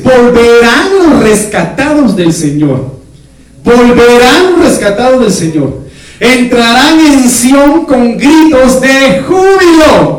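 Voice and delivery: lento y enfático, como proclamando; lectura predicada. Acted. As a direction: proclama la escritura despacio. Lee Isaías con fuerza. (predicador lee Isaías a la congregación, lento y solemne)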